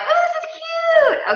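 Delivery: very high, drawn-out squeal of delight; gushy mock squeal; delighted cooing. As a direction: coo over it in a high, slow voice. Retelling delight at new tank top.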